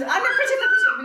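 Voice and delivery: put-on high, excited little-girl plea, with a laugh; daughter's voice. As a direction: squeak it like a child asking mum for a tissue.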